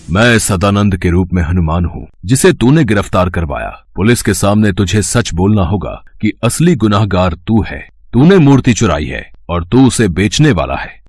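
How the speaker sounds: normal talking voice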